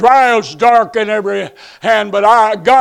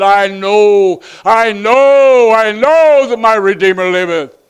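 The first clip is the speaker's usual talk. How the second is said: triumphant declaration; 2 semitones higher, 5 dB louder than usual. Acted. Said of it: "he rose up and said".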